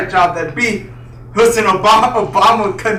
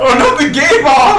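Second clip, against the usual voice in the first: loud, higher mock alarm; 7 semitones higher, 5 dB louder than usual. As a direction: cry out in fake horror.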